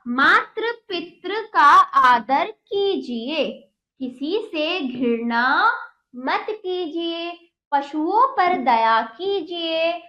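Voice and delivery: very slow, emphatic word-by-word reading; deliberate dictation-like story reading; read-aloud lesson. Acted. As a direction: read it out slowly for young pupils, pausing between words.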